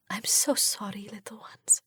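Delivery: toneless whisper, very soft. A narrator's reading. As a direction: breathe the words to the dead children. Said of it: "I whisper".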